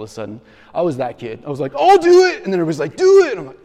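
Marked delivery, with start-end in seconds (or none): 1.7-2.4 high, loud eager volunteer; eager kid. Retold or imitated. 3.0-3.3 high loud crowd chant; egging on. Retold or imitated.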